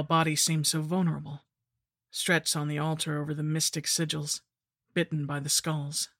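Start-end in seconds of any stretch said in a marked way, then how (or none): none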